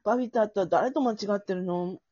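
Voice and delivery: high, loud, playful pout; hammed-up sulky cutie; pouting girlfriend. Jemi pouts at Babita for forgetting her name, high and loud. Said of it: "私、ジェミーね".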